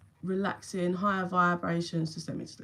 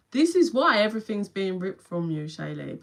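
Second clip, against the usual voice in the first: ordinary talk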